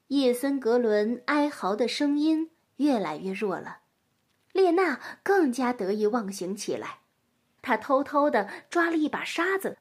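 ordinary delivery

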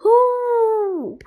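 long, high-pitched howl; playful imitated howling; wolf howl. A narrator's reading. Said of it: "and howled again".

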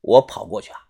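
ordinary delivery

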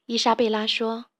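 ordinary delivery, nothing marked

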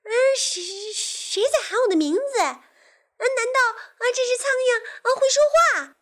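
very high, loud astonished character voice; startled frog. Acted. Frog wondering if the fly can talk, high startled voice. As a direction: squeal it in cartoon disbelief.

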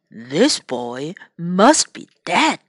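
put-on low, slow bear musing over the boy. A narrator's reading. Bear deciding the boy is dead, low and slow. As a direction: rumble it out like a bear sniffing its prey. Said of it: "said the bear".

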